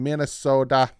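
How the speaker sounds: put-on playful stab at a Minnesotan accent. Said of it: "Is that a Minnesotan accent?".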